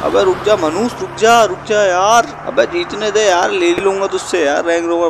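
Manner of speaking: high begging shout; pleading shout. Acted. Begging the rival to let him win, high pleading. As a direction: whine for the win.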